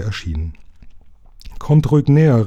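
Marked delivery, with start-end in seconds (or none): 1.6-2.5 higher-pitched reassuring call; raised, coaxing hail. A narrator's reading. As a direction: call over to someone far off. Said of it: "rief Lukas ihnen zu".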